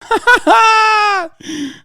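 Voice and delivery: high-pitched burst of laughter breaking the bit; cracking up mid-impression. Real, not acted. Streamer breaking into a high laugh mid-impression.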